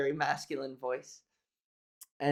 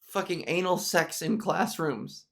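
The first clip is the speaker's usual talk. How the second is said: ordinary talk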